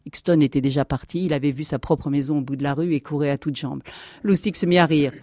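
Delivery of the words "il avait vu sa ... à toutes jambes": plain reading voice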